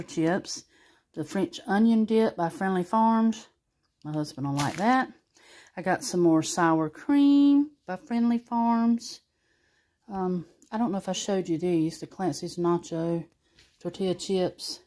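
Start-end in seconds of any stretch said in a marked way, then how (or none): none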